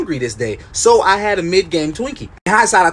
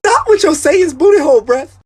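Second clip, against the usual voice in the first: disgusted yell; 10 semitones higher, 4 dB louder than usual. Retold or imitated. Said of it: "I was like".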